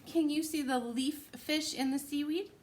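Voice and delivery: usual speaking voice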